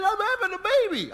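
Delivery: high, loud, fast outburst; urgent wife. Retold or imitated. Wife telling husband she's in labour, urgent high cry.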